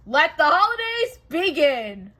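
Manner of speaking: slow, loud proclamation; announcing. Acted. Queen Elsa to the townspeople, grand announcement. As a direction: declare it to the whole courtyard. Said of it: "Then Elsa announced".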